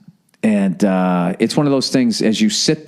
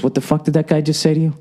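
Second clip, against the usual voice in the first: imitating Poppy; 7 semitones higher, faster than usual. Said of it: "he goes".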